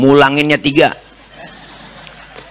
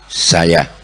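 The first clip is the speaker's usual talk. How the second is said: gentle Prophet; 8 semitones lower than usual. Acted. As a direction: answer humbly from outside. Prophet answers at door. (Nabi menjawab pelan dari luar)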